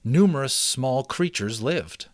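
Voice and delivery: steady, lively read-aloud narration; story narration. A narrator's reading. Reading a mouse adventure to listeners, storytelling voice. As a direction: read it like a chapter book to listeners. Reads chapter book aloud.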